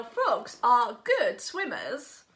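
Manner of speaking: ordinary delivery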